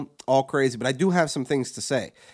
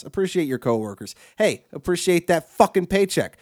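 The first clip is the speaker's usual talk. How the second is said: ordinary talk, nothing marked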